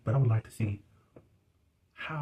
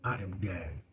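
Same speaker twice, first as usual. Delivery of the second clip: hushed shock; 2 semitones lower, slower than usual. Real, not acted. Stunned reaction to the gossip.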